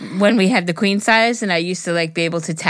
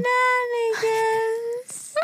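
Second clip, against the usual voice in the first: high, slow fake cry on the word; mock sob; 5 dB quieter, much slower than usual. Reacting to the goodbye with a fake cry, high and drawn out. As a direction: whimper it like a pretend cry.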